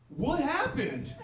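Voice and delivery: slow, high "What happened?"; a bystander's slow, high-pitched what happened; drawn-out question. Retold or imitated. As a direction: stretch it out like a baffled bystander. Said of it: "he goes".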